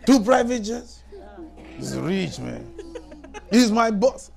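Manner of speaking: mostly loud, high bragging with a laugh in it; half-laughing mock shout of pride; retold shouting. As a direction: show off the boss to everyone. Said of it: "he started shouting".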